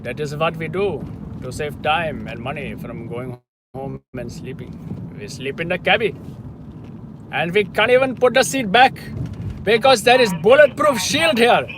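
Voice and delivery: loud, high hammed-up Indian accent; Indian cab driver. Spotting a yellow cab, he plays its Indian driver in a loud high accent. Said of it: "we need a yellow cab for that impression".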